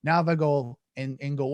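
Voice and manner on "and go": ordinary delivery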